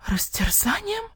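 breathless, rising whisper of dread; frightened whisper. A narrator's reading. The fox, pale at the penalty, whispers in fear. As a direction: barely breathe the word out. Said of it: "Лиса побледнела".

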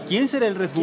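mock mysterious teaser. Mocking the comic's mystery teaser.

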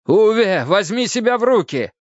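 sharp, high-pitched urging; urgent scolding. Acted. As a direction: order a dazed man to pull himself together. Urging a dazed man to rouse.

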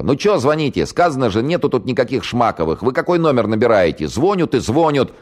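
high, loud, nagging yell into the phone; shrewish shouting. Acted. Woman snaps at the caller on the phone, high and loud. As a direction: scold the caller like a cranky housewife. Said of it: "сварливо закричала".

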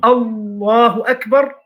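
«الله أكبر» with a long, held «الله»; demonstrated takbir. Shown as a demonstration. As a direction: intone «الله أكبر» as if opening the prayer. Demonstrates the opening takbir of prayer. (تكبيرة الإحرام بمدّ «الله»)

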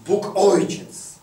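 loud, high, slow proclamation; preaching at full force. Acted. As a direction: proclaim it like law from the pulpit.

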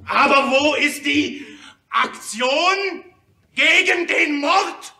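impassioned outcry. Acted. Speaker crying out to delegates, impassioned. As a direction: demand an answer, near tears.

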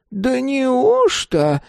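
put-on high, drawn-out old lady's surprise; astonished elderly lady. A narrator's reading. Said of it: "поразилась пожилая леди".

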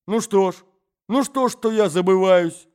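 громко и высоко, сквозь злость; наступает на собеседника, яростно; в бешенстве. Acted. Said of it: "в бешенстве приступая". (молодой человек в бешенстве наседает на господина)

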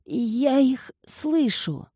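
high, slow frail murmur; frightened feeble old man; weak old voice. Acted. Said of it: "слабым старческим голосом".

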